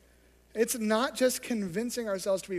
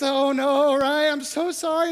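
father apologizing; 6 semitones higher, 8 dB louder than usual. Retold or imitated.

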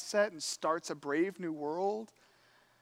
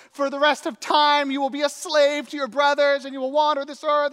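loud, raised proclamation of a curse; hammed-up cursing, high and loud; mock scream; 4 semitones higher, 7 dB louder than usual. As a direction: act out a father thundering at his son. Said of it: "he's screaming at him".

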